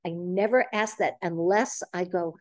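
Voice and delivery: ordinary talk, nothing marked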